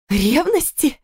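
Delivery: high, outraged disbelief; incredulous echo. Acted. Wife scoffing at husband's claim of jealousy. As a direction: fling the word back in disbelief.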